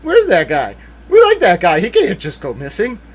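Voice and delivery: loud, high mock indignation; imagined government protest. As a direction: act out officials demanding answers. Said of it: "government would probably be like".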